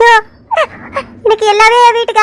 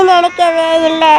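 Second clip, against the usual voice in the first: crying lament; 4 semitones lower, slower than usual. Acted. Widow lamenting to sister-in-law, sobbing and slow. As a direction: break down on the repeated words.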